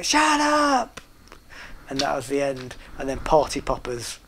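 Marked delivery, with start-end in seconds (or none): 0.0-1.0 mock shout. Ending of the routine.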